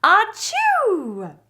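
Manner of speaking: huge, loud high sneeze; big sneeze. Acted. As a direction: blast out a giant bear's sneeze. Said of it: "makes the bear say".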